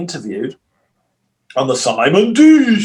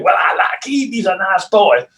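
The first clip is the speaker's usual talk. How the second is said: imitating Stefan; 5 semitones higher than usual. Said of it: "Stefan going".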